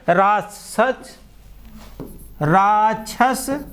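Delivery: slow, spaced words for writing down; slow dictation. Shown as a demonstration. Teacher dictating synonyms slowly so students write. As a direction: spell each synonym out slowly to the class. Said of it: "slow dictation of synonyms".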